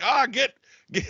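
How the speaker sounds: high, aborted stab at Scottish; half-formed Scottish impression; mock Scottish accent. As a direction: start into a Scottish accent, then give up. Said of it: "about to go Scottish on that one".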